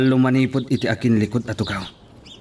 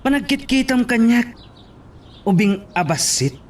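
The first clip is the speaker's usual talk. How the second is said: high, scornful jeer; mocking taunt; 11 semitones higher than usual. Lieutenant taunting Briggs, high and mocking. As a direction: sneer down at him like a child.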